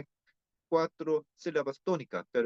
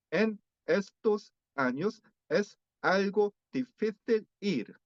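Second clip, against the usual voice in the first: slow, every syllable pronounced separately, pitched higher; Korean learner version; 5 semitones higher, slower than usual. Shown as a demonstration. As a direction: pronounce each syllable of estos años clearly, no linking. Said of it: "Así se pronuncia".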